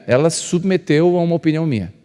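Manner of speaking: ordinary talk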